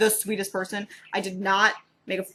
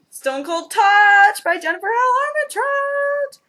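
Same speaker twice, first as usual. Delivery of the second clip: loud, high, drawn-out title reveal; thrilled, stretched-out high cry; excited announcement; 12 semitones higher, 10 dB louder, slower than usual. Real, not acted. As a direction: belt out the book title like a hyped announcer. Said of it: "I'm very excited for this".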